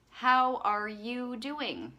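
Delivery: heartfelt, drawn-out check-in; genuine asking. Real, not acted. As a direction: draw it out and really mean it.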